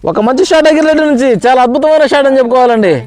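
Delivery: high, thrilled call of the shot; excited commentary. Real, not acted. Commentator reacts to big shot.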